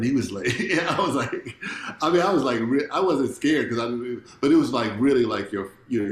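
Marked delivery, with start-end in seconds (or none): none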